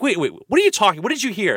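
high, rapid questioning; alarmed dad. Retold or imitated. Dad interrupts his son, high and rapid. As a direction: cut in fast and high. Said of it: "my dad's like".

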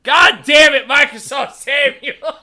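loud exasperated laughing curse. Acted. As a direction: yell it in fake frustration. Exasperated at the robot voice.

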